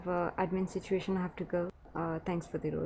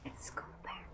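hushed whisper, barely voiced; 4 semitones lower, 16 dB quieter than usual. Real, not acted. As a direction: whisper so nobody hears. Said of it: "You gotta be quiet".

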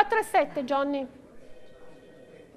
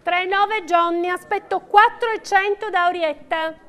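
steady rhythmic bid calling; auctioneer calling bids. Real, not acted. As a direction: call out each bid to the room.

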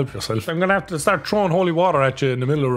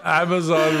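ordinary talk, nothing marked

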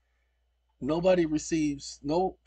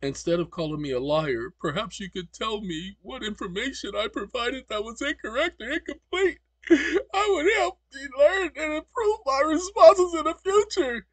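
high, whiny mock robot voice; rising into a high, loud fake whimper; mocking the AI's reply in a whiny voice; mocking the chatbot; 10 semitones higher, 4 dB louder than usual. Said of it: "he says".

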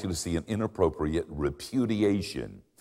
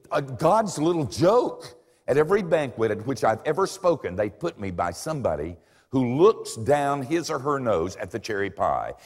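ordinary delivery

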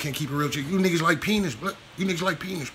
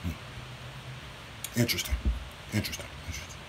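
quiet sarcastic musing; 7 semitones lower, 10 dB quieter than usual. Real, not acted. Sarcastic musing after rant.

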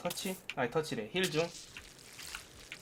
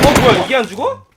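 surprised outburst; 6 semitones higher, 13 dB louder than usual. Real, not acted. Enemy survives shots in a fight.

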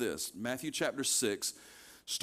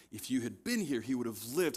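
ordinary delivery

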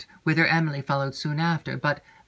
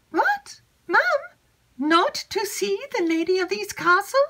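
put-on much higher, eager young maid's voice; chattering maid Annette. Maid Annette eagerly seeking Emily, high excited chatter. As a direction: gush it out high and breathless with news.

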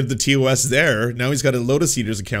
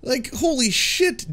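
much higher and slow, aghast; stunned outrage; 14 semitones higher, slower than usual. Real, not acted. Host confronting the guest over empire, high, slow and aghast. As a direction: spell out the horror in disbelief.

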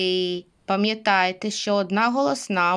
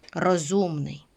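usual speaking voice

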